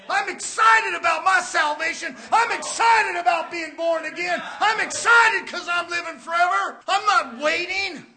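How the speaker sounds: loud, driving pulpit shout; exultant sermon delivery; excited preaching. Real, not acted. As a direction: hammer out each declaration to the congregation. Preaches at full force.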